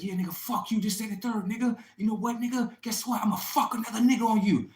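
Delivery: high-pitched mock of an angry girlfriend, building to loud. Girlfriend threatening to cheat, imitated high and building. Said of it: "She's like".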